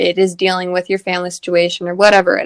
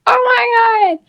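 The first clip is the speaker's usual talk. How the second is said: excited; 4 dB louder than usual. Retold or imitated. Excited reaction to gift.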